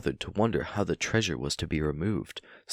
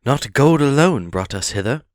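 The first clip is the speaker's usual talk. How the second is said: higher, louder, forcing courage; a small hero standing up to a dragon; brave hobbit voice; 8 semitones higher, 9 dB louder than usual. Acted. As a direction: Bilbo plucking up courage to answer Smaug. Bilbo answers the dragon.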